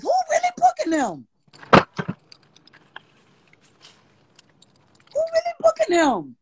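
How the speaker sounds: a mocking high voice asking who really books them; mock falsetto. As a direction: float it up high like a taunt. Teasing rhetorical question.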